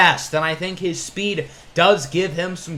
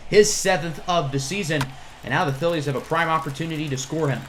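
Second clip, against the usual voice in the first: ordinary talk, nothing marked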